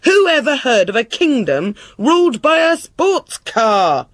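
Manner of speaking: high-pitched indignant outburst; exasperated king losing his temper; voice rising. A narrator's reading. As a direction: let outrage push the pitch up. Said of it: "His voice rose".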